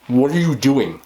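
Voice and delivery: ordinary talk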